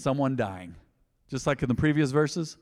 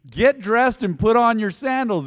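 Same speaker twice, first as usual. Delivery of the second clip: loud, higher, emphatic order; angel's command; 8 semitones higher, 7 dB louder than usual. Acted. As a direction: rouse a sleeper with slow force. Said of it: "The angel's telling him".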